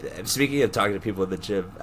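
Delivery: ordinary talk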